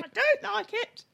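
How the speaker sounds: put-on very high, loud old granny voice; frail great grandma. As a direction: ham up a shrill little old granny. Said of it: "really old granny voice".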